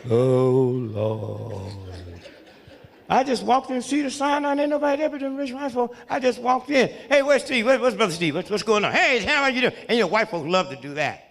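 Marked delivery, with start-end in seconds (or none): 0.1-1.7 hammed-up sad groan, lower pitch; mopey sigh. Shown as a demonstration. Comic mocking a sad patient walking into the hospital, mock-sad groan. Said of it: "Don't walk in all sad". 7.1-9.7 loud, higher, mock-eager hello; hammed-up hospital welcome for a rich patient; cheery staff welcome. Hospital staff greeting the wealthy comic, loud and eager.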